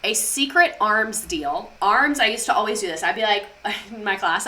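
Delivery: ordinary talk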